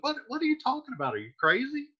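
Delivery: incredulous. Retold or imitated. Benvolio baffled by Romeo.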